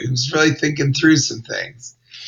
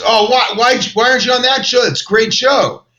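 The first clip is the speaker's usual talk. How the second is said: higher, eager dopey voice; mock bodyguard; 9 semitones higher than usual. Comic imitating the bouncer, higher and eager. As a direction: play him clueless and keen. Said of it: "And he goes".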